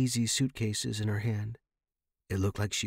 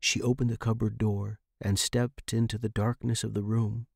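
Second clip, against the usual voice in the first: ordinary delivery, nothing marked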